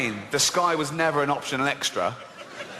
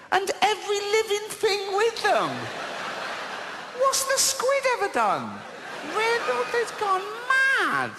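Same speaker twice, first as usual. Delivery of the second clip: much higher, incredulous voice; high incredulous protest at God; outraged high squeak. Acted. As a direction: shriek in disbelief at the flood. Outraged squeak at God's flood.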